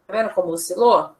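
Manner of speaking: ordinary talk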